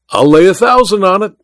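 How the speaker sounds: high, eager wager; excited cry. A narrator's reading. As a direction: cry out the bet. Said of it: "cried Welton".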